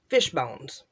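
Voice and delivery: normal talking voice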